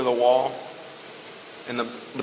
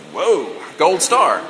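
slow, swooping sarcastic praise; hammed-up, underwhelmed congratulations; mock amazement; 2 semitones higher, slower than usual. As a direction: exaggerate being impressed, drawn out. Mocking praise for a small favour.

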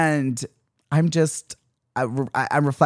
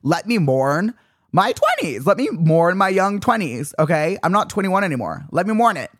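high-pitched, fast, fed-up retort; a mock-outraged rant with the voice pushed up; exasperated rant; 5 semitones higher than usual. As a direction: snap back at everyone, indignant and hurried.

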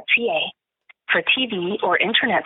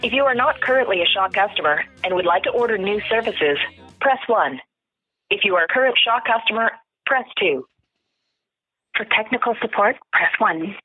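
phone menu voice. Real, not acted. Shaw phone menu played.

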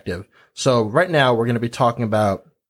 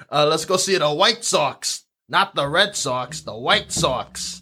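slow mock Chicago accent; drawn-out Chicago sports-fan accent; slower than usual. Shown as a demonstration. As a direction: stretch the vowels like a Chicagoan. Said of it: "Chicago accent".

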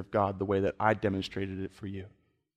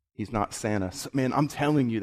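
ordinary delivery

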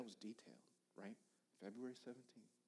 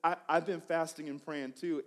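laughing while quoting her; 6 semitones higher, 17 dB louder than usual. Retold or imitated. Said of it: "But then she goes".

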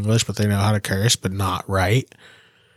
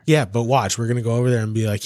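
ordinary talk, nothing marked

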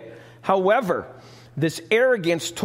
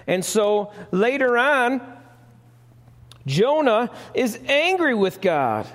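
usual speaking voice

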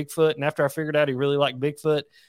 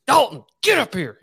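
raised, higher teacher voice; calling pupil up; 9 semitones higher, 3 dB louder than usual. Retold or imitated. Teacher summoning a second grader, mildly stern raised voice. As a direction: call a kid sternly to the front.

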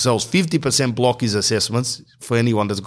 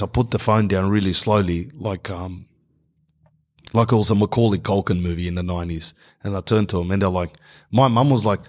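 ordinary talk

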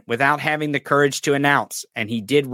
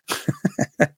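high laugh. Real, not acted. Laugh after taunt.

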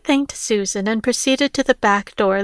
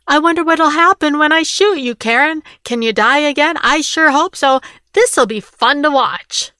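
higher, louder gloating threat; menacing taunt; 5 semitones higher, 5 dB louder than usual. Acted. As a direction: relish every word of the threat. Rick taunts Karen with gun.

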